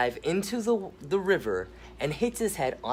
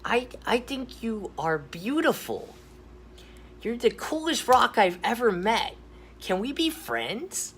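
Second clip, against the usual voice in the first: much higher, earnest cartoon voice; sweet gator voice; 10 semitones higher than usual. Gator telling the sad rock she is beautiful, high earnest. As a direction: comfort her in a high gentle cartoon voice. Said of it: "Nathan looks at Rose and says".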